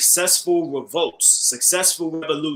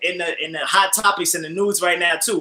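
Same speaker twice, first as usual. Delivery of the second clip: ordinary talk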